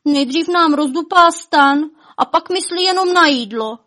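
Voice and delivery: high, loud complaining wail; lamenting. A narrator's reading. Elves resenting the dragon picking apples, lamenting.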